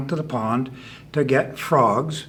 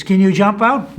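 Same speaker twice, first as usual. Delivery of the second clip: loud, higher rabbit call; raised voice, rising question; 7 semitones higher, 8 dB louder than usual. Acted. Rabbit calls down the hole.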